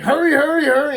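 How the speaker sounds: loud, high urgent calls to hurry. Retold or imitated. Guard rushing the kid to pack up, loud urgent call.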